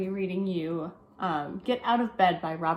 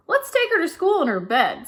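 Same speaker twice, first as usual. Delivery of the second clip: eager brother; 9 semitones higher, 5 dB louder than usual. Acted. Brother's idea.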